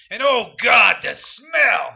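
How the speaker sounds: loud disgusted outcry; disgusted shout. Acted. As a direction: yell it in revulsion. Disgusted outburst about humans.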